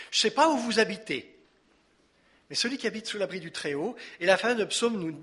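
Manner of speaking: usual speaking voice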